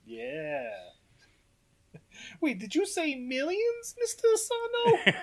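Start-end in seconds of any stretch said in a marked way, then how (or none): 2.4-5.1 very high, slow comic voice; hammed-up puzzled sidekick; dim henchman act. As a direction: question the boss slowly in a squeaky pitch. Acting out dim henchman's query.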